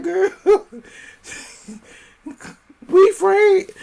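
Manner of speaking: high laughing cry. Real, not acted. Laughing while quoting Lauren to a friend.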